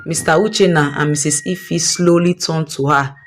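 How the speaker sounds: plain reading voice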